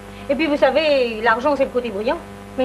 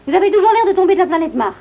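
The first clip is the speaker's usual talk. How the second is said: higher, louder irritated snap; exasperated scolding; 8 semitones higher, 6 dB louder, faster than usual. Acted. Exasperated rebuke of assistant.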